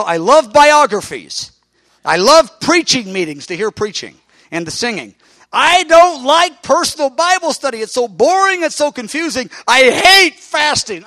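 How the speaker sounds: put-on high, loud young-man griping about boring devotions; whiny complaint. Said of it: "I go".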